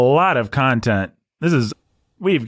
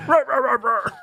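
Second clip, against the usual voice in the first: battle chant. Acted. Warrior chant.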